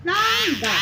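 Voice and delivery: तांगा high, first vowel hummed through the nose; nasal pronunciation. Shown as a demonstration. Teacher blending the syllables for children, तांगा high and nasal. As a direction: lift and stretch the nasal start of तांगा. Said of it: "हमारा नाक से उच्चारण हुआ".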